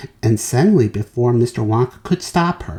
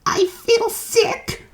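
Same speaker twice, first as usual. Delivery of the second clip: put-on sickly little girl; queasy girl; much slower than usual. A narrator's reading. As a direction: say it feeling ill. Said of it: "Violet said".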